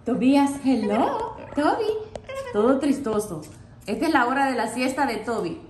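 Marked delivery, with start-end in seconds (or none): none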